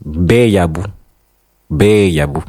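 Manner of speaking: "Beyabu" said slowly and carefully; vowel length demo. Shown as a demonstration. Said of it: "Par exemple".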